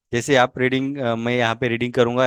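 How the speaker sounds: ordinary talk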